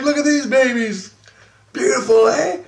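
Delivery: high, goading show-off voice; very high, crowing delight; gleeful showing off. Retold or imitated. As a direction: sing it out high to taunt. Showing off again to the kids.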